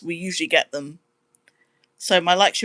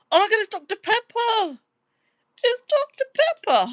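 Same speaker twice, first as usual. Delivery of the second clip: much higher, delighted squeal of recognition; excited squeal. Real, not acted. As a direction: squeal with joy at a familiar name. Sees chat name Doctor Pepper.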